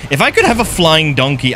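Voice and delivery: normal talking voice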